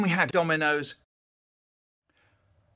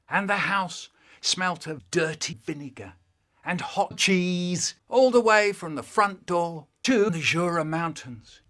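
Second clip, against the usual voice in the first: ordinary delivery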